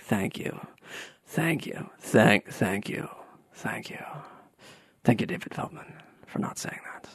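near-whispered, breathy Baldwin thank-yous; hushed thanks. Retold or imitated. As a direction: murmur it low like a relieved actor. Baldwin quietly thanking Feldman.

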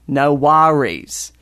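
slow, rounded Australian "no worries"; corrective, drawn-out Australian accent. Retold or imitated. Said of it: "kind of, I don't know, sort of round and looping".

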